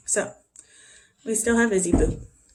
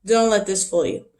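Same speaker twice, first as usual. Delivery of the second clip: ordinary delivery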